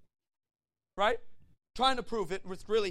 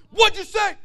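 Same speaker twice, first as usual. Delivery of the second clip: high, very loud fighting shout; David all fired up; 12 semitones higher, 12 dB louder than usual. Acted. Young David answering the giant's insult, fired-up shouting. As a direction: yell it like you're itching for a sword. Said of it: "David gets all fired up".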